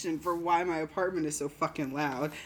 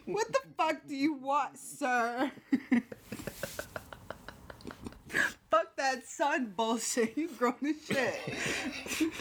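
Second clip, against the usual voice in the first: mock-outraged parent, pitched higher; playful raised-pitch scolding; exasperated parent; 7 semitones higher, slower than usual. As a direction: turn down a grown son, dragging the end. Playing the parent refusing him.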